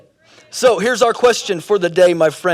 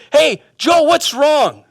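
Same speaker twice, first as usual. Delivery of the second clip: high, loud, concerned call; hailing a friend; 7 semitones higher, 5 dB louder, slower than usual. Acted. Friend asking withdrawn Joe what happened, high loud hail. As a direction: call over with a pitched-up question.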